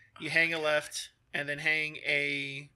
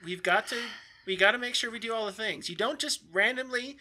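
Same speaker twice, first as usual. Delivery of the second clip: raised, higher-pitched rule-bound voice; officious guard; 6 semitones higher than usual. Acted. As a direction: lecture them on the rules in a high voice. Game master voices the guard.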